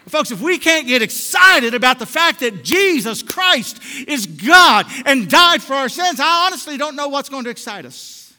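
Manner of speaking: loud, high, impassioned pulpit cry; fired-up preacher raising his voice; full-force preaching. Real, not acted.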